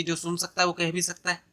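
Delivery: same sentence rattled off fast and light; quick restatement. Shown as a demonstration. Teacher recapping the definition for students, quick and light. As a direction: run through it quickly as a recap.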